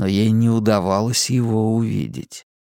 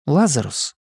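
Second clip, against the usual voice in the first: higher, wary question; questioning woman's voice; 3 semitones higher than usual. Acted. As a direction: call out his name warily. Simona calls him out.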